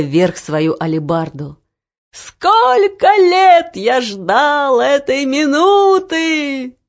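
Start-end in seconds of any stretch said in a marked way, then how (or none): 2.1-6.8 high, slow, exultant cry; full-lunged shout. A narrator's reading. Guard rejoicing after years of waiting, drawn-out shout. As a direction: draw the shout out loud and high. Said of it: "Во всю силу лёгких кричал стражник".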